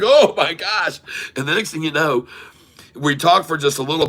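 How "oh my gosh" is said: high, loud imitation of astonishment; amazed. Ladies reacting to a traveler speaking Creole, amazed. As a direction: mimic their amazed outburst, pitched up.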